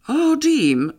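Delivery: high, loud cry of his name; a startled, high exclamation; exclaimed. A narrator's reading. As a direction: call out his name in alarm. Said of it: "wykrzyknęła".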